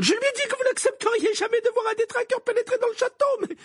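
very high, strained furious shout; thundering. A narrator's reading. Professor recounts her warning about the castle, thundering. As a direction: yell it out at the top of the register. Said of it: "tonna le professeur McGonagall".

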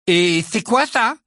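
slow, offended challenge to the insult; angry. A narrator's reading. Ron challenges the portrait that diagnosed him, angry. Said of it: "demanda-t-il avec colère".

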